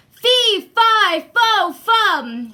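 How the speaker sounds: booming giant. Acted. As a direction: roar the giant's rhyme. Giant's chant.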